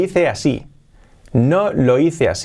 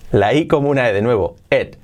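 normal talking voice

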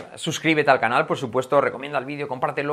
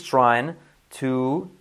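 slow drill; much slower than usual. Shown as a demonstration. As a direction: draw out "get better" syllable by syllable. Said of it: "¿vale?". ("get better" muy lento)

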